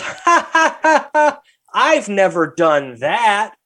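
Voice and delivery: high-pitched fake ha-ha, then a drawn-out taunt; mocking kid's laugh. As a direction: imitate a kid laughing at someone. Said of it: "then the kid starts laughing".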